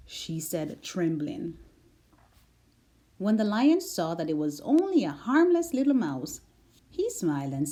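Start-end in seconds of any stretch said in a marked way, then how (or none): none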